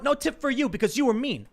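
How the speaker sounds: high, loud mock scolding; angry. Passenger denying the rude cabbie a tip, mock angry. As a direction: act out a petty angry refusal. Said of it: "could have been angry at him".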